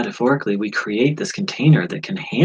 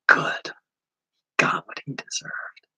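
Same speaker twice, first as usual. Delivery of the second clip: little inner voice; 7 semitones higher, slower than usual. Retold or imitated. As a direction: let a small sly voice whisper it. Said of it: "I heard this little voice go".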